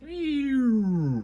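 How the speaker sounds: high, squeaky mock ew; hammed-up yuck; mock disgust. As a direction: let it out like a grossed-out kid. Mocking disappointing fight scene.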